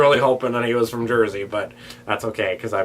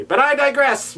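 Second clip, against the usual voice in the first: excited; 6 dB louder than usual. Real, not acted. Sudden exclamation, jumps high.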